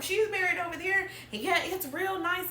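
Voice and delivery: tossing it off like it's nothing; imitating her mom. Mimicking her mom answering from the car, high and offhand. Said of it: "She's like".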